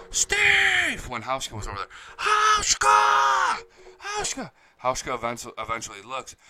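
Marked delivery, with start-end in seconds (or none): 0.1-1.1 high, loud, drawn-out call; imitated yell. Fan in the front row calling to Steve, imitated yell. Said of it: "he starts yelling". 2.2-4.5 very high, drawn-out holler, easing off on the repeat; imitated yell. Fan trying to get Hauschka's attention, imitated yell. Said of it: "he starts yelling".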